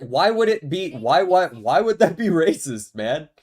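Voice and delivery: jokey defensive outburst with a laugh; playful indignation. Real, not acted. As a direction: push back laughing, half-outraged. Joking protest over racist accusation.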